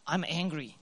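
ordinary talk

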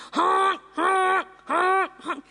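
high, loud mock honking; imitated horn honks. Team honks at Lori to leave, loud high honks. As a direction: blast it like a bus horn.

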